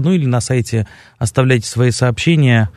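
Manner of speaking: ordinary delivery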